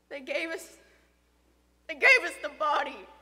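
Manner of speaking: halting, then bursting out loud; grief-stricken. Acted. Father recounting daughter's body, grief-stricken.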